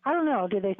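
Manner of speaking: ordinary talk, nothing marked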